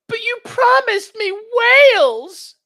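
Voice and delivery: high, slow, hammed-up whine; shrill mock complaint at full volume; movie quote. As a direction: squeal it like a spoiled child. Quoting favourite movie line.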